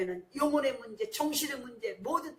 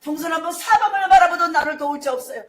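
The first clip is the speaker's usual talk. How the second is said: loud, higher cry of 아버지 fading to a plea; crying out in prayer; 4 semitones higher, 12 dB louder than usual. Retold or imitated. Preacher voicing desperate prayer, loud cry fading. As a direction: shout to the Father, then soften. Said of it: "기도하는 거예요".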